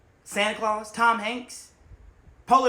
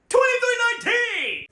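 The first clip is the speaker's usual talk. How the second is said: high, loud shouted code; loud quoted alarm; movie alarm quote; 11 dB louder than usual. Retold or imitated. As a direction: yell it like the film.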